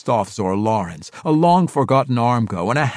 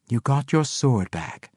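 dryly; 3 semitones higher than usual. Acted. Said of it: "Sarabel replied dryly".

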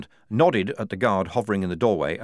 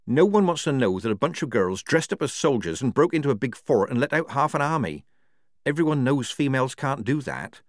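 bitter character voice, pitched up; Tonka, bitter prisoner; 6 semitones higher than usual. A narrator's reading. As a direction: lay out the grim truth, higher than narration.

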